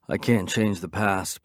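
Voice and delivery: usual speaking voice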